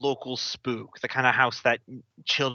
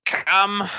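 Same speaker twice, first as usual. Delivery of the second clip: creaky hag voice from within; creaky old woman; 8 semitones higher, 5 dB louder than usual. Acted. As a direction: croak it like an ancient crone. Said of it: "you hear a creaky voice from within".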